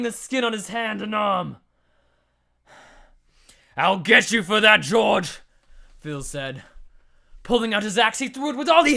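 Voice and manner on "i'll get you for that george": loud, angry threat; angry vow. Acted. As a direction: spit out the vow. Burned hero vows revenge.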